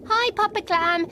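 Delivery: high, cheerful greeting; jellyfish character. Acted. Ellie greets the clam, high jellyfish voice.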